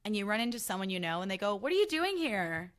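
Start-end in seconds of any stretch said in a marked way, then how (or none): 1.6-2.7 a lightly mocking, raised "What are you doing here?"; condescending quote. Acquaintance surprised to see her at a party, faintly condescending. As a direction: sneer the question a little higher. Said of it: "Like, condescendingly".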